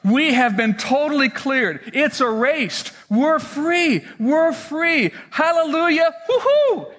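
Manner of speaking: building giddy relief, ending in a high woo-hoo; excited celebration. Retold or imitated. Forgiven servant texting his wife, rising to a whoop. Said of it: "his wife going".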